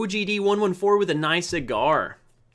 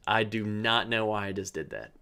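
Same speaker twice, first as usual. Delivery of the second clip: usual speaking voice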